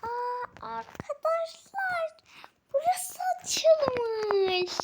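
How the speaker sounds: high, slow, drawn out with a laugh; thrilled. Real, not acted. Kid showing viewers the opened room, thrilled and drawn out. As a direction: stretch it out in joyful wonder.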